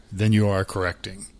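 ordinary talk, nothing marked

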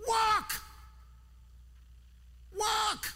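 preacher hammers the word Walk up high; shouted emphasis. Shown as a demonstration. Preacher drills the answer into a sleepy class, shouting. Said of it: "What's the emphasis in John five".

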